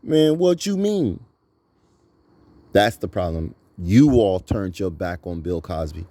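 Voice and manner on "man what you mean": high, slow, mocking reply; mocking dismissive reply. Mocking the man who brushes it off.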